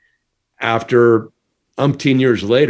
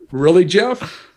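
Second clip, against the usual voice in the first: self-mocking disbelief; 8 semitones higher, slower than usual. Scolding himself in disbelief.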